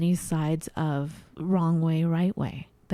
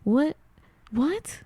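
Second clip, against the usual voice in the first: puzzled; 8 semitones higher than usual. Retold or imitated. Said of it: "I can hear myself".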